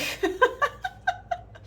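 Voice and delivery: high, breathy embarrassed giggle. Real, not acted. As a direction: giggle at her own mistake. Embarrassed after miscounting the cash.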